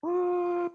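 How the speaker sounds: very high, loud imitated whoop; mock the crowd's empty yell. Preacher imitating a concert crowd's empty yell, as a very high, loud whoop. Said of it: "Y la gente grita".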